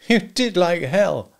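high, slow, scornful retort; angry disbelief. A narrator's reading. Husband rejecting wife's excuse, angrily. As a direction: throw her excuse back at her.

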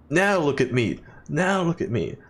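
lightly put-on silly voice, rising each time; hint of comic voice. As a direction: repeat it as a light running gag. Repeating a line as comic voice.

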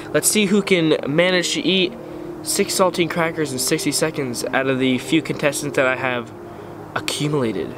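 ordinary talk

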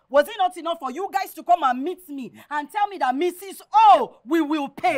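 loud, indignant telling-off; angry scolding. Acted. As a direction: scold him loudly, outraged. Landlady angrily scolding tenant.

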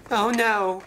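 high-pitched cry of dismay; frightened cry. A narrator's reading. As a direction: exclaim high in storybook alarm. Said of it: "he cried".

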